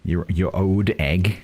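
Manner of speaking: slow put-on regional accent; Ernie's accent. Gamer toying with a fun phrase, slow put-on accent. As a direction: draw out a playful character accent. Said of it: "fun to say in an Ernie's accent".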